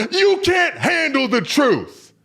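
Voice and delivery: high, slow, full shout; Colonel Jessup. Retold or imitated. Colonel on the stand answering his questioner, explosive drawn-out shout. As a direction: roar it out, stretching the words. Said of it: "to Colonel Jessup".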